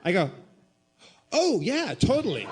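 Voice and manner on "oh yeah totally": breezy confirmation. Retold or imitated. Blithely confirms the haunting.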